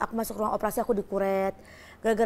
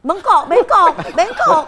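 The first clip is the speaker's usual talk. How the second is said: high, loud, repeated correction breaking into laughter; loud teasing correction; 7 semitones higher, 12 dB louder, much slower than usual. Real, not acted. Woman corrects the guest's slip of the tongue, loud and laughing. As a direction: call out the right word over and over, amused.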